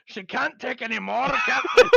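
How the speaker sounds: strained high engineer cry; high, loud Star Trek engineer; Scotty impression. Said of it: "I'm giving it all I've got, Captain".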